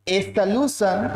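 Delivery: slow, evenly spaced dictation. Real, not acted. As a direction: dictate it slowly for note-takers. Teacher dictates a definition to class.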